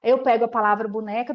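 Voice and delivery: ordinary delivery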